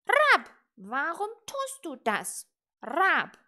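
loud, high squawking question; questioning raven croak. A narrator's reading. Raven to little witch about her spitting, croaked question. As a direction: croak the question between caws. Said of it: "krächzte nach einer Weile der Rabe".

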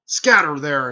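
loud sudden command to scatter; barked order. Retold or imitated. Examiner orders the genin to scatter, loud command. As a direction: call it out like a proctor sending teams off.

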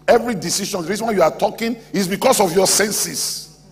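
high, insistent sermon delivery; emphatic preaching. Real, not acted. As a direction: drive the point home forcefully. Preaching at full force.

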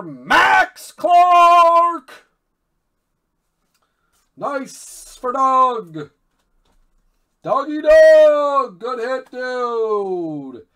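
real high, very loud celebration; excited shouting. Real, not acted. Card breaker celebrating Doug's Max Clark hit, excited shouting. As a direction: shout the hit out, stretching key words.

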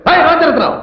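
sharp rising call of the name; angry shout. Acted. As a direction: bark the name in outrage. Hero shouts at minister by name. (கோபமான அதட்டல்)

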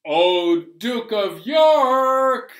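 loud, high, drawn out; excited call. Acted. Librarian loudly announcing the Duke to the kids. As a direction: hail him like a herald.

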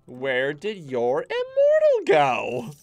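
loud, high, drawn-out demand; mock-outraged yell; mock scolding shout. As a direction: holler it slowly in exaggerated disbelief. Mock scolding the players' units.

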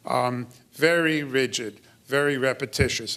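ordinary talk, nothing marked

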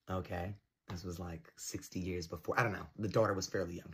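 usual speaking voice

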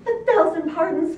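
high, loud pig squeal; loud, high-pitched piggy apology. A narrator's reading. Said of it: "squealed one".

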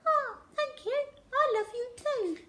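very high, tiny squeaky rat; a little rat saying thanks sweetly. A narrator's reading. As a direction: Armitage the rat answering Zoe, tiny and high. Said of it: "from rat to English, translates as".

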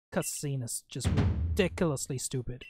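ordinary talk, nothing marked

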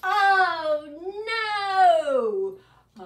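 loud, playful crying oh no; mock wail oh no. Shown as a demonstration. Librarian fake-cries in the song for the children, loud. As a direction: wail it in hammed-up dismay. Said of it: "the part where we go, "Oh no".

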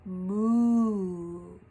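a low, drawn-out moo; cow moo. Shown as a demonstration. Teacher modelling the farm cow sound, mooing. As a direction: give a gentle cow moo. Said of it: "The cow on the farm says".

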